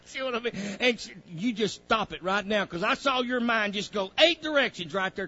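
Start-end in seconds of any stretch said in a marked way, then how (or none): none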